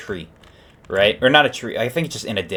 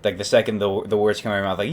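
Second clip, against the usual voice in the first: ordinary talk, nothing marked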